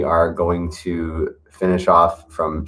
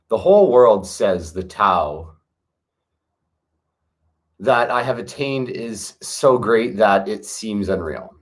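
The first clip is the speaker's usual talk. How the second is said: steady meditative recitation; scripture reading; 2 semitones higher than usual. Acted. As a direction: read each line like a sacred verse, unhurried. Reads Tao Te Ching chapters aloud.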